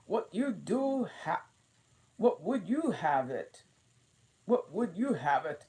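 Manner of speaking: high, loud bossy queen. A narrator's reading. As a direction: boss her along. Queen's lines.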